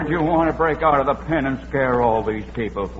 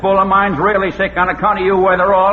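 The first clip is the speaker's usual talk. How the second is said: angry threat; 8 semitones higher, 7 dB louder than usual. Acted. To Witherall over his sick bull, loud angry threat. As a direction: shout him down, furious.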